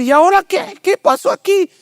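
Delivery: a stranded driver suddenly at a loss; puzzled driver. Retold or imitated. (conductor desconcertado, voz mucho más aguda)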